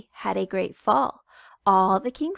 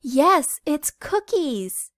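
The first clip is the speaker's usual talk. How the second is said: loud, high chick, then slow on cookies; proud little chicken; 6 semitones higher, 6 dB louder, slower than usual. Acted. Little chicken proudly telling Papa it's cookies, loud and high. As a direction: crow out the big surprise.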